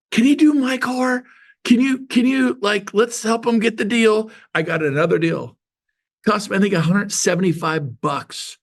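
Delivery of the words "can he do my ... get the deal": high, eager imitated office voice; eager coworker. Coworker wants her car done too, imitated eager high voice. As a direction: mimic a coworker angling for a favor. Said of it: "She muffles the phone, but I could still hear".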